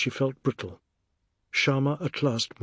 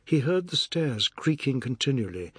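plain reading voice

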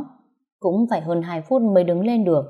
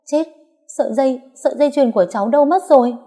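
higher, louder, fast panic; panicked; 7 semitones higher, 5 dB louder, faster than usual. Acted. Wife discovering her necklace missing, in a fast panic. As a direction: blurt it out in sudden alarm. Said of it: "mặt đầy hoảng hốt".

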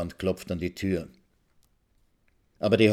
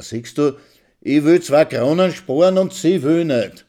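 loud and higher; indignant complaint; 7 semitones higher, 8 dB louder than usual. Acted. Husband complaining to the old woman, indignant and loud. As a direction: appeal to her outraged.

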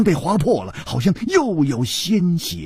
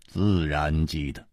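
low, soft, slow assent; calm reply; 10 semitones lower, 7 dB quieter than usual. Acted. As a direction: answer slowly, well below the challenger. Hero calmly answers a challenge. (低沉从容地回答)

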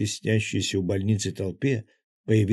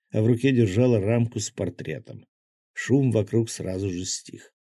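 ordinary delivery

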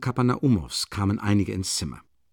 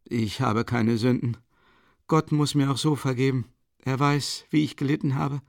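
high, frail voice of a dying mother; dying woman voice; 5 semitones higher than usual. A narrator's reading. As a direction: plead feebly with the children. Dying mother speaks in story.

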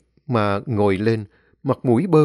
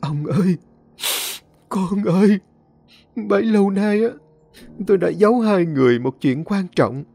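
tearful confession; 7 semitones higher than usual. Acted. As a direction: confess the secret through tears. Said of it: "vừa khóc vừa nói". (vợ vừa khóc vừa thú nhận với chồng, giọng cao nức nở)

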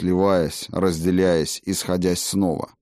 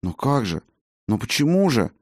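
puzzled questions, the second one pitched higher; bewildered muttering; 5 semitones higher than usual. A narrator's reading. Healer being dragged out of the gate, bewildered. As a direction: ask it in helpless bewilderment. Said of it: "недоумённо бормотал он".

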